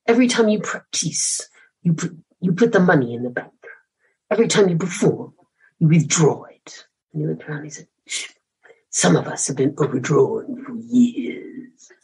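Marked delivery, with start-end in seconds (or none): 0.1-6.9 a hint of a Hungarian accent, teacher lecturing; light Hungarian accent. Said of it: "let me try if I can do the accent a bit better". 8.1-11.9 put-on slow, hushed Hungarian-accented aside. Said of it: "let me try if I can do the accent a bit better".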